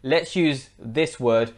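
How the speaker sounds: ordinary talk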